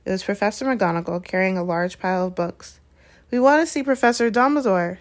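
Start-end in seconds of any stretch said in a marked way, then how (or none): 3.3-5.0 higher, plucky statement; rather brave. A narrator's reading. Hermione asks McGonagall for Dumbledore, rather bravely. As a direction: speak up bravely to a teacher. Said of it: "said Hermione rather bravely".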